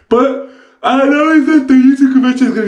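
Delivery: high, excited burst; excited hype. Real, not acted. Vlogger hyping YouTube convention to viewers, excited.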